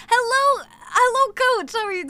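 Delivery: high, loud sing-song hello; excited greeting. Real, not acted. Streamer greeting her friend coach, high excited hello. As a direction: greet them brightly, high up.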